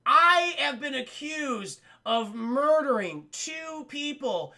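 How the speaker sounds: slow, high outraged yell; angry rant. Retold or imitated. Prisoner raging at the judge over bail, slow outraged yell. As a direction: spit out each word in disbelief. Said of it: "He's like".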